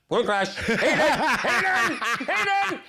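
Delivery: high, panicked cries to the driver; mock panicked yell. Passenger retelling crash fears to driver, panicked yell. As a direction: yelp the driver's name in comic terror.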